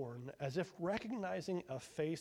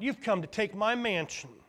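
accusing; 6 semitones higher, 8 dB louder than usual. A narrator's reading. Brother accusing firstborn.

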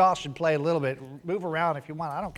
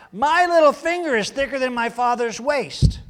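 boastful king; 8 semitones higher, 7 dB louder than usual. Retold or imitated. Preacher voicing arrogant young king.

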